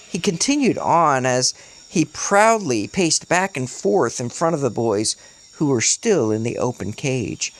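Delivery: plain reading voice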